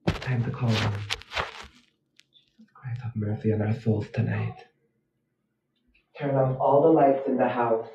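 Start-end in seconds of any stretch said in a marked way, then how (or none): 0.9-4.6 low whispered plea for mercy; hushed prayer. Real, not acted. Streamer prays before summoning Jeff, hushed. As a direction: pray softly, afraid.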